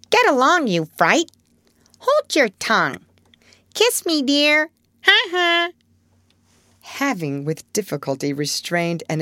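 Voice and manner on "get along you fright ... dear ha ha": high screechy parrot voice. A narrator's reading. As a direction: squawk it like a cheeky parrot. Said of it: "imitating her as well as he could".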